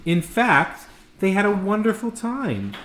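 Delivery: plain reading voice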